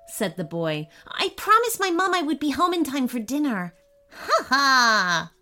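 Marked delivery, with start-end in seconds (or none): 1.1-3.7 put-on higher, quick boy's voice; worried boy. A narrator's reading. Boy tells the imp he is expected home, worried. 4.1-5.3 drawn-out stagey "ha ha"; acted imp's cackle, second beat long and strong; mocking imp laugh. A narrator's reading. Imp laughs at the boy's plea, mocking. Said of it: "laughed the imp".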